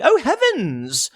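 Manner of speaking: slow, high mock-shocked gasp; hammed-up fussy elite; posh elite gasp. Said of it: "political elites going".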